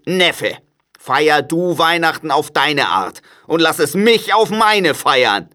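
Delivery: crotchety uncle laying down terms; grumpy Scrooge, stern. A narrator's reading. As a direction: put the nephew off firmly. Scrooge's voice in the story.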